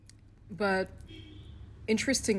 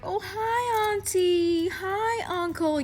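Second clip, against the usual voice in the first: high, sugary mock greeting to elders; fake sweet greeting; 12 semitones higher, slower than usual. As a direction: imitate a fake-nice girl sucking up to the aunties. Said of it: "They're the types who would be like".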